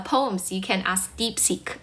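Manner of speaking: usual speaking voice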